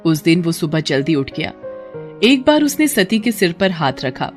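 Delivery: usual speaking voice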